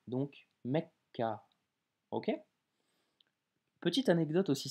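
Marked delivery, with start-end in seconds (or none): 0.1-1.4 Mecca slowly, k held long; doubled k. Shown as a demonstration. Teacher showing the doubled k, slow. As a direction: hold the kk in Mecca. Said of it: "faut doubler le K".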